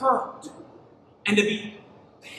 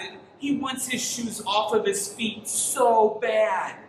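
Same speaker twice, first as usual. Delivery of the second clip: ordinary talk, nothing marked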